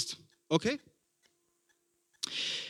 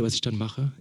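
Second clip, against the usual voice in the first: usual speaking voice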